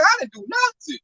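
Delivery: high, feigned innocence; mock innocent. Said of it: "I like".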